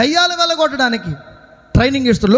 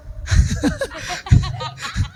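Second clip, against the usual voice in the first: laugh; 3 semitones higher, 5 dB quieter than usual. Real, not acted. Preacher after asking the congregation who they are, soft laugh. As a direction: laugh lightly to yourself.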